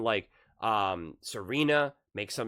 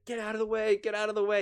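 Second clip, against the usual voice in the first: mock yell; 11 semitones higher, faster than usual. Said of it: "he's like".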